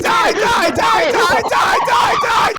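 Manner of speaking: frantic yelling. Acted. Attacking the British intruder, frantic high screaming. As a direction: berserk battle screeching.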